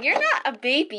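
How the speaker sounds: ordinary talk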